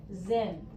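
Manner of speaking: zin said short and quiet; reduced schwa. Shown as a demonstration. Teacher to second graders, demonstrating the schwa. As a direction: clip the zen of frozen to a quiet zin. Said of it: "we say I".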